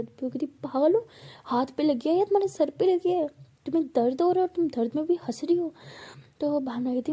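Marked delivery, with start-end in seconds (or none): 0.5-5.7 higher, louder, incredulous telling-off; exasperated scolding. Acted. Pihu scolding Bhavna for laughing in pain, exasperated. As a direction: scold her like she's lost it.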